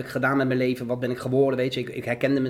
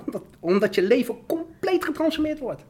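emotional; 8 semitones higher than usual. Real, not acted. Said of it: "word ik weer emotioneel".

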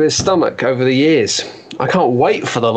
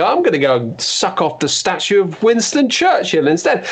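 ordinary talk, nothing marked

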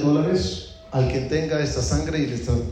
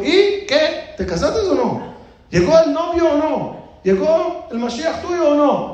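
very high, insistent questioning; eager pressing questions; 13 semitones higher, slower than usual. Acted. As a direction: pester with high, drawn-out questions. Rabbi presses dreamer about marriage. (preguntas insistentes en tono muy agudo)

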